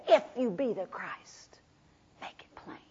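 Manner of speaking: hushed whispered challenge; mocking whisper. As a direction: whisper the taunt coldly. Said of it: "those that just said".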